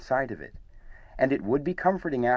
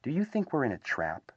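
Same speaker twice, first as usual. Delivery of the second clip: mild nervous question, higher and softer; 4 semitones higher, 6 dB quieter than usual. A narrator's reading. Small frightened animal asks his friend about a trap, nervously. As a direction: ask it nervously, in a softer raised voice.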